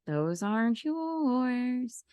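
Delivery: slow, soft, drawn-out threat; menacing claim. Pretend. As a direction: lay it down slowly like a villain. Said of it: "Boss Glow come out and be like".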